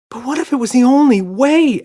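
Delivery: high loud pleading cry. A narrator's reading. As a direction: cry out desperately. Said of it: "Emma cried".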